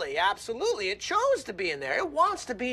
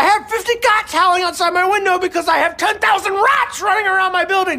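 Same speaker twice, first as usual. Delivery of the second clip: loud, higher, exasperated rant; yelling; 7 semitones higher, 10 dB louder than usual. Acted. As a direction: yell your defence at them in frustration. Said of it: "now stop yelling".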